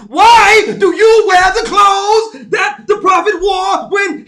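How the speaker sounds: high, drawn-out mock sermon shout; hammed-up khutbah yelling at full volume; yelling preacher impression. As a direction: holler each phrase slowly like an overwrought preacher. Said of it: "He yelled when you're not supposed to yell".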